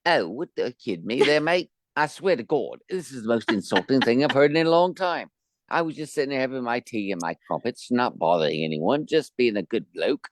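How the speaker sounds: hammed-up Cockney bloke, offended; mock British accent. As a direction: play an outraged Londoner over tea.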